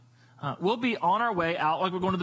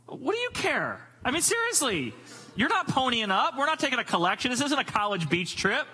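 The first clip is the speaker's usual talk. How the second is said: higher, incredulous mock scolding; exasperated parent; 7 semitones higher than usual. Parent to kid on beach trip, playfully exasperated. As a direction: brush off the kid's worry in comic disbelief. Parent dismissing kid's money worry.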